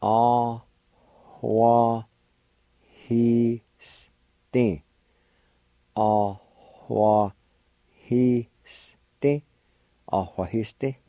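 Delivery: Ah, wa, he, sti with a light breathy h on he; faint h sound. Shown as a demonstration. Teacher showing the faint H in the word for buy. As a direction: let the h in he come through faintly. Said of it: "there's a faint H sound".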